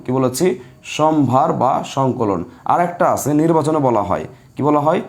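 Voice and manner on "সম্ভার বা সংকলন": slow, measured repeat for note-takers; unhurried classroom dictation. Shown as a demonstration. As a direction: spell it out word by word at half speed.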